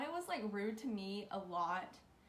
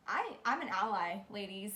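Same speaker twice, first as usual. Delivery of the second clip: normal talking voice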